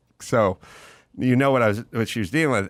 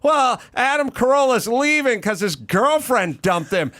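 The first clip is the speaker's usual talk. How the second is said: imitated blustery announcement, yelled very high; Cynthia Seghetti; 13 semitones higher, 3 dB louder than usual. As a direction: bellow it to the whole class, imitating a merciless teacher. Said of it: "her trademark, was yelling".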